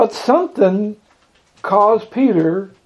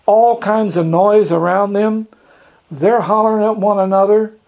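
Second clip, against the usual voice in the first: usual speaking voice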